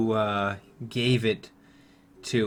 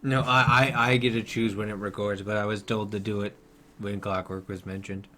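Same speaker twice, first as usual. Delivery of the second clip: ordinary talk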